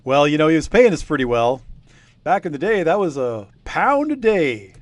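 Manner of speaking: a hint of a chatty old villager spinning a yarn; old local villager. Game master voicing old villager telling the investigators, lightly voiced.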